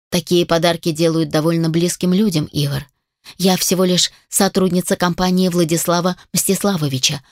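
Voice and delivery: plain reading voice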